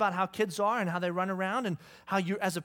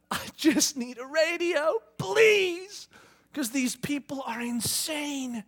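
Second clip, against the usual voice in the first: high, slow, worn-out begging; exasperated plea; 6 semitones higher, slower than usual. Acted. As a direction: plead like a parent at wits' end, drawing out the words. God imagined at wits' end.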